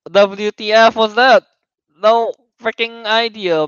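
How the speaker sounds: slow, loud disbelief; baffled. Real, not acted. Host reacting to the ending, slow baffled disbelief. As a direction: drag it out, truly bewildered.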